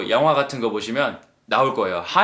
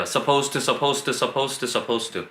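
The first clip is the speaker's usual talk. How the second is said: drilling repetition; 3 semitones lower, 4 dB quieter, much slower than usual. Shown as a demonstration. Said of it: "입에 배야 돼요".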